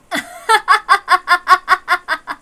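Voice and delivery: a high, loud mocking cackle; sneering laugh. Villain sneering at George in the rain, high mocking cackle. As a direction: laugh down at him with contempt.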